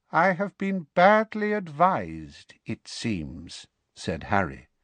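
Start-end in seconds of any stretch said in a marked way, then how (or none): 0.1-3.7 high, slow, icy menace; cold anger under a high voice. A narrator's reading. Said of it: "in a high, cold voice that pulsed with anger".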